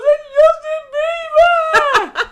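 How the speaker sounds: put-on high, slow, laughing shriek of a girl fan; screaming girl fan. Girl in the crowd spotting [name], high shriek. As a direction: shriek it like an overexcited young fan. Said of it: "And then some girl goes".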